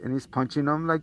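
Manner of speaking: usual speaking voice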